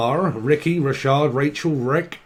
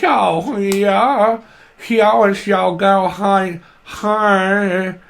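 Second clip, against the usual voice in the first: mock Southern voice; 5 semitones higher, 4 dB louder, much slower than usual. Keeps mocking the spirit voice.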